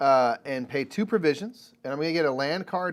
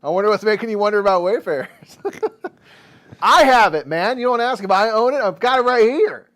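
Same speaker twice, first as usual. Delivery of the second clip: mock indignant rant; 6 semitones higher, 9 dB louder than usual. Mock indignant rant about friend.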